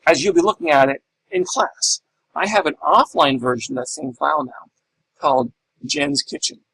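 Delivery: ordinary talk, nothing marked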